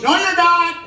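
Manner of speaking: high, loud heckle; heckling shout. Retold or imitated. Heckling passenger yells at pilot, high and loud. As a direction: yell it at the pilot like a drunk heckler. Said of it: "You say".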